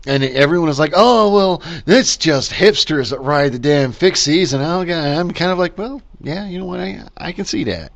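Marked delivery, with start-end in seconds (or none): 0.9-4.5 higher, louder mock dismissal; mimicking everyone. Vlogger quoting everyone in Bend on fixies, mimicking. 5.8-7.9 soft, high-pitched concession; mock reluctant agreement. Vlogger replaying own reply about hipsters, quietly conceding.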